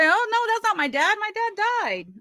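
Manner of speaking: high, put-on voice of a daughter brushing it off; mock innocent denial. Vlogger mimics how she could have denied her dad was hers, in a high mock voice. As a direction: pitch it way up, as if playing innocent.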